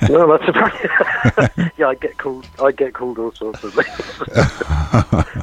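laughing. Real, not acted. Musician joking with host about being called all sorts, laughing. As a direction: chuckle through the words.

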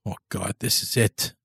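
soft, fearful breath of despair; quiet terror as the engine dies; whispered panic; hushed dread. Acted. Harry bound, van stops.

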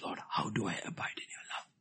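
hushed voiceless question to the Lord; soft whispered plea; whispered prayer. Real, not acted. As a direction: breathe the question like a secret prayer.

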